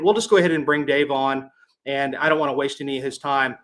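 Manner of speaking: ordinary talk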